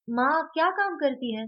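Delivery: put-on high, loud boyish question; child asking. A narrator's reading. Vedant asking grandmother what mother does, high and eager.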